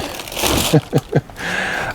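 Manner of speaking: quiet relieved chuckle; soft breathy laugh. Real, not acted. Relief no animal inside.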